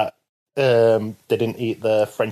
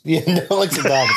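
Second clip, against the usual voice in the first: laughing; 3 semitones higher than usual. Real, not acted. Echoing a mate's joke about the doll, laughing. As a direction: shout it through a laugh.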